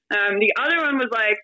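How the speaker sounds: ordinary talk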